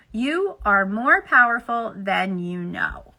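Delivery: slow, deliberate read-aloud; slow emphatic repeat. Acted. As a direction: let each word land slowly. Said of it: "we ask you to hear these words newly".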